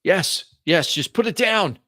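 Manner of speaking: put-on high, urgent old man; old man's voice. Old man wanting the package back, old man's voice. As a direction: plead as an anxious old man. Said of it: "An old man's voice".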